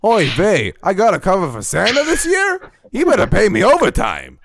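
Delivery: put-on high, loud Yiddish accent elf; kvetching elf in Yiddish accent.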